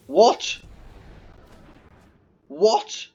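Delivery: high, loud incredulous what; shocked yelp of disbelief; stunned disbelief. Real, not acted. Streamer shocked by his auto tapper, high incredulous cry.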